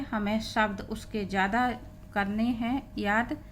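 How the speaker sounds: ordinary talk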